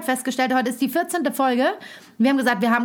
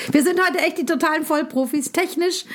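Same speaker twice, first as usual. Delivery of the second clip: ironic boast; 4 semitones higher than usual. Acted.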